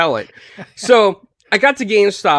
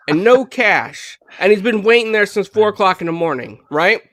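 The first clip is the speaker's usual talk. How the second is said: normal talking voice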